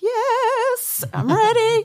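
high, loud overeager squeal; eager yes. Retold or imitated. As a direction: jump at the chance, way too keen. Eager reply to the offer.

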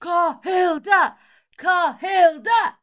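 rally the crowd behind her; cheering. Acted. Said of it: "Juna starts cheering".

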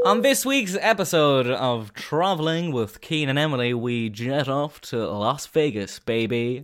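slow, big announcer voice; show host intro. Acted. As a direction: roll it out like a show opener. Segment intro.

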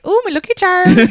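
high, loud Lucky Charms mimicry; hammed-up American take on an Irish accent; mock Irish-cartoon impression. Americans mimicking the Irish, loud high mock accent. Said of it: "so Americans are like".

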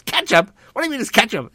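high, loud indignant yelling; outraged disbelief, shouted up high; angry yell. Retold or imitated. Driver yelling at Leno over ketchup, loud outraged. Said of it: "the guy starts yelling at me".

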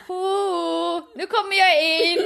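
high, drawn-out warning call; mock announcing call. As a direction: call out playfully, imitating him entering. Imitates warning call before entering.

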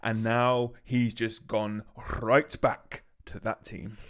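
slow, weighty Victorian storyteller cadence; Dickensian cadence. Shown as a demonstration. Reading a fan's text aloud in a slow Dickensian cadence. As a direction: draw it out like a Dickens narrator. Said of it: "repeat that last bit in a kind of, like, Dickensian, um, kind of cadence".

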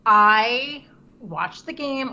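slow, pointed stress on "I"; emphatic insistence. Real, not acted. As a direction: lean hard and long on "I". Insisting she did watch the game.

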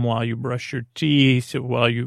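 ordinary talk

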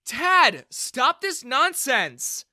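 loud, high and slow command; sharp shout. A narrator's reading. Bella shouts to stop her father's prank. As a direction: cut the fight off hard.

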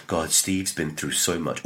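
a hint of an overserious actor, slow; lightly hammed-up actor gravitas; mock earnest actor. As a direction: sigh it out like a solemn method actor. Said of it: "overplay the importance of what they do".

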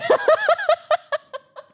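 shrieking high laugh; a burst of high laughter. Real, not acted. Laughing at Garfy name.

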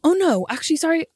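ordinary talk, nothing marked

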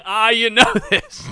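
mocking imitation. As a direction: parrot her words back mockingly. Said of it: "Stop saying".

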